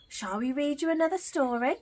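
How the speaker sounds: ordinary delivery, nothing marked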